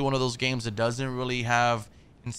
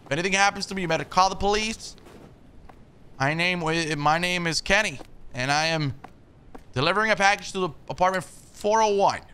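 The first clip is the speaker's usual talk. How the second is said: loud, higher call up the stairwell; 7 semitones higher, 5 dB louder than usual. Real, not acted. Delivery guy calling to stranger on the fourth floor. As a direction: call out to the man upstairs.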